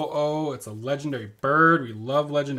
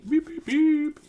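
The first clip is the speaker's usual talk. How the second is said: high beep noises; 4 dB louder, slower than usual. Pretend. Dad imitates a censor bleep.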